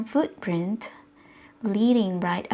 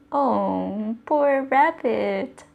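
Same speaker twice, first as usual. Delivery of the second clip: usual speaking voice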